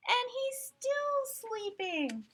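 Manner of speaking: high, slow and amazed. Acted. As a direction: marvel at it for the kids. Reader marvels, high, slow.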